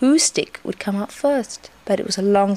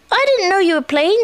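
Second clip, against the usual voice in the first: high, louder little voice; surprised young Roo; 11 semitones higher, 5 dB louder than usual. A narrator's reading. Roo finds Eeyore playing, high and surprised. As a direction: pipe up brightly and high.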